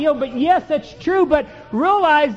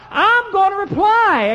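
emphatic climax; 9 semitones higher, 3 dB louder, slower than usual. Acted. Climax of the sermon.

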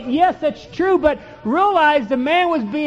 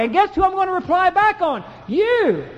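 preaching at full force; 6 semitones higher than usual. Acted. Sermon builds to God's warning.

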